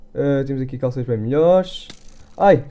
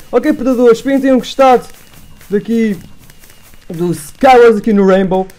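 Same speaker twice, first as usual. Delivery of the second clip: louder, upbeat sign-off voice; youtuber outro; 5 semitones higher, 7 dB louder than usual. Acted. Youtuber thanking his predadores viewers, upbeat and louder. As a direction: wrap up the video like a presenter.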